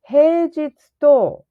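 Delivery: high, slow and loud; loud high demo. Shown as a demonstration. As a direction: say it out clearly and slowly. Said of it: "you go like this".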